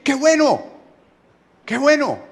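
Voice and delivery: high, approving cheer; approving exclamation. Acted. Preacher voicing what one might say, high approving exclamation. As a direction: exclaim it high, as a pleased hearer would. Voicing a pleased hearer's reaction.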